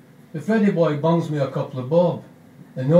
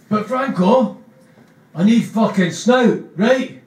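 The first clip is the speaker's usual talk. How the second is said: higher, louder angry rant through a laugh; Begbie snapping; 8 semitones higher, 5 dB louder than usual. Acted. Begbie complaining to Spud about smoking, loud and angry. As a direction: bark it like a hard man. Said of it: "Begbie isn't chuffed at all".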